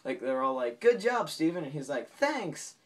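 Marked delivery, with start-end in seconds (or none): 0.8-1.6 mock congratulation. Acted group congratulating Steven. 2.1-2.7 high-pitched, cheerful thanks; playfully acted perky gratitude; chirpy imitated reply.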